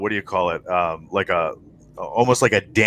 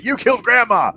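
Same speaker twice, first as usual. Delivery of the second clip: mock accusation; 13 semitones higher, 3 dB louder than usual. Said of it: "in the Twitch chat is saying".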